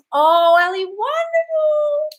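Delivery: very high, loud, drawn-out wonderful; gushing delight. Real, not acted. Praising Ali's news in high, slow sing-song.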